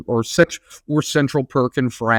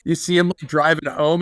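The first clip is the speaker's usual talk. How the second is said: normal talking voice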